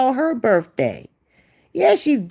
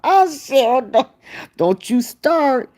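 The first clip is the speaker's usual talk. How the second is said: high, laughing exclamation; cracking up mid-sentence; real burst of laughter; 4 semitones higher than usual. Real, not acted. Laughing at own joke.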